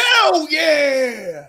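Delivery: big rap-style hell yeah shout; shouted like a rapper. Shown as a demonstration. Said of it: "Like a rapper".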